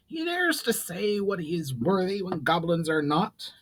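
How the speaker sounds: hammed-up indignant goblin cry; elderly goblin lightly shouting at the elf; mock shout. A narrator's reading. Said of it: "shouted the elderly goblin".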